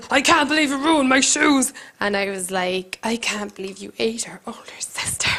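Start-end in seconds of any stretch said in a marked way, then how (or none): 0.1-1.7 a hint of the angry older sister; lightly voiced sister's complaint. Retelling the older sister's rant about ruined shoes, mild mock voice. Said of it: "that's the way she talks". 3.0-5.3 softer, unvoiced jab; whispered retort. Whispering a cruel comeback at the sister.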